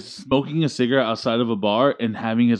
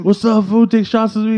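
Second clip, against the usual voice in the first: rowdy greeting; 9 semitones higher, 3 dB louder than usual. Retold or imitated. Chris greets and pushes shots.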